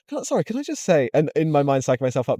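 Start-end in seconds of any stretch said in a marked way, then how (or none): 0.1-1.1 hesitant high quote. Retold or imitated. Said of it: "I was like".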